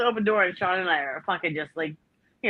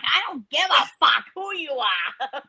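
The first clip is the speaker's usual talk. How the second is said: retold shout; 6 semitones higher than usual. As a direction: shout it back at him, slowly. Yelling back at the boss.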